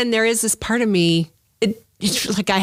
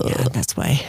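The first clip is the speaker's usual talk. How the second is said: breathy hushed whisper, secretive; 9 semitones lower, slower than usual. Pretend. Patient whispering her alien backstory to a friend.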